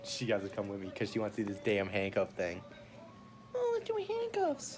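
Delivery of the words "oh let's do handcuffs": high slow mocking voice. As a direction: mimic her, high and drawn out.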